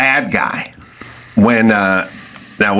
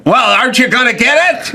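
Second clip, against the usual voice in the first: higher huffy demand; hammed-up radio husband; impatient old-movie man; 14 semitones higher than usual. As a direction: snap like a thirties detective. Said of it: "acted radio scene".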